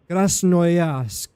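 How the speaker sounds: very bad Russian accent, pushed out. Gamer echoing a station name, bad Russian accent. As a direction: repeat the place name in a hammy Russian accent. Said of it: "bad Russian accent".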